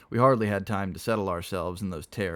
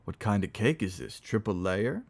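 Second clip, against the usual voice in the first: ordinary delivery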